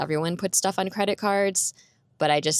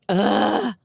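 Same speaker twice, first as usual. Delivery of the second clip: loud, higher-pitched "ugh"; exasperated groan; 4 semitones higher, 5 dB louder than usual. Retold or imitated. Retold exasperated reply to God.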